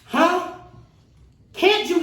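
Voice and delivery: high, loud, jabbing demand; bully the prisoner with each question; a jailer screaming at a woman being beaten; retold screaming. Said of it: "I kept hearing a man screaming".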